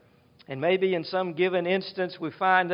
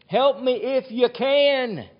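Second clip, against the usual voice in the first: higher, pleading cry for help; desperate father pleading; 6 semitones higher than usual. Acted. Father begs Jesus to heal his son, pleading. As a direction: beg as a father out of options. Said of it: "And the man is desperate".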